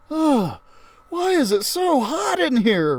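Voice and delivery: put-on high, whiny kid voice complaining of heat; a boy melting in the heat, high and fretful; Ralphie, hot and bothered. Ralphie whining to the class about the heat, high and whiny.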